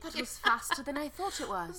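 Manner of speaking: high, loud caricature of British speech; British accent. Acted. Repeating the contestant's amazement in a hammed-up British accent. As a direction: put on an over-the-top British voice. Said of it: "the most offensive British accent".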